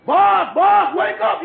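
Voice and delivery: loud call to wake him, boss, wake up. Acted. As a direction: call him loudly out of his sleep. Waking the boss.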